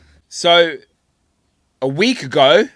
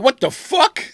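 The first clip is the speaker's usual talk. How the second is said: high-pitched mock disbelief; hammed-up incredulous reaction; imitated startled spirit; 11 semitones higher, slower than usual. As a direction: play a spirit taken aback. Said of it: "He'd be like".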